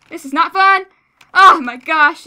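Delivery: loud, high distressed yell; panicking gamer. Real, not acted. Being chased, distressed.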